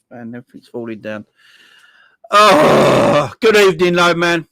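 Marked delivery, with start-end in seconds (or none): 2.3-4.5 loud greeting. Real, not acted.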